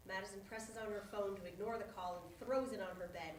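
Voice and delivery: ordinary delivery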